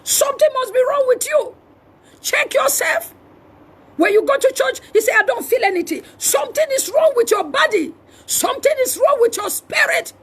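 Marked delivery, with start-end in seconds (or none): none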